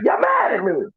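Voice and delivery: high, fast, whiny protest with a shouted start; exasperated self-defence. Retold or imitated. He snaps back at the room, high exasperated shout. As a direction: plead your case way up high, almost shouting. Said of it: "I'm like".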